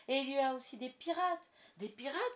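high, loud eager shout; boy eager to meet pirates; excited cry. A narrator's reading. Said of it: "cria John".

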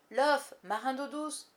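loud, high sailor's call; shouted order. A narrator's reading. Irish pirate hailing his mate, shouted order. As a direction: call it out slowly across the water. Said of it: "cria une voix irlandaise".

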